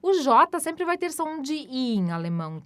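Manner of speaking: ordinary talk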